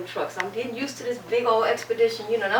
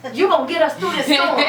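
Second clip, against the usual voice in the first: loud, higher, scolding retelling of the husband; husband fussing; 6 semitones higher, 6 dB louder than usual. Retold or imitated. Husband scolding his scared wife driving in a storm, loud and sharp. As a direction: bark it at her like he's scolding her through the storm. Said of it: "And he started fussing at me".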